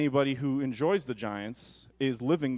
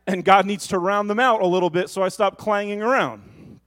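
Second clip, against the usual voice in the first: louder, higher preacher's build; preaching at full force; 5 semitones higher, 4 dB louder than usual. Acted. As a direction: drive the point home to the congregation.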